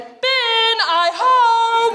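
high, loud sing-song call; excited greeting. Retold or imitated. Toddler home from nursery greeting the bin, high sing-song call. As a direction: imitate a toddler joyfully calling out.

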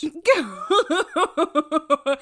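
loud high burst of laughter; big laugh. Real, not acted. Laughs at the story.

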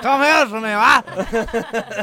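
high, loud, laughing Irish Magneto impression; shouted Irish Magneto. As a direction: bellow it like a rowdy Irish Magneto. Mocking Irish Magneto shouting at Xavier.

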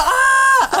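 very high, loud mock wail; hammed-up crying scream; imitated scream. Mum imitating her partner amid baby chaos, high mock scream.

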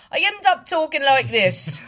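high, loud put-on British accent. Shown as a demonstration. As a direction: break into a silly British accent. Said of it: "doing my little British accent".

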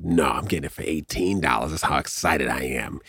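low, soft, deadpan buying voice; online-shopping voice. Shown as a demonstration. Paying eighteen dollars right away, low soft deadpan voice. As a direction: murmur it low like clicking buy now. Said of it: "that's my voice when I'm buying something online".

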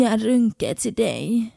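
slow, creepy DM voice; mock creep. Mocking a creep's DM, slow and sleazy. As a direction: read it like a sleazy message. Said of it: "som bara".

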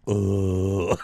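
low, drawn-out mock groan of indifference; imitated disgusted groan. Imitating an unimpressed player watching his brother score, weary groan. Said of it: "Eli's just like".

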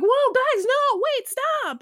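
high, loud mock panic; imitated freaking out. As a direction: cry out to break up a fight, imitating a bystander. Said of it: "freaking out".

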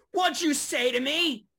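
high, loud brawler's outburst; really loud challenge. Acted. Tavern brawler challenges another drinker, shouting high and loud. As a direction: shout it like someone picking a fight. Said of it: "someone says really loudly".

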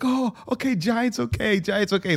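put-on high, relieved little child's voice; imitating relieved toddler. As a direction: chirp it like a comforted toddler. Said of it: "It's like".

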